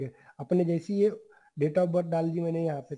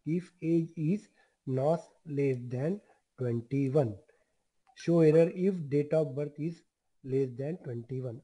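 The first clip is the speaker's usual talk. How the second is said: each word spaced out for students to type; slow dictation; much slower than usual. Shown as a demonstration. Teacher dictating to the class, slow and deliberate.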